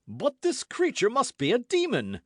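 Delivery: high, loud horrified outburst; horrified exclamation. A narrator's reading. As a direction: recoil at the thought of her. Porthos horrified at Milady.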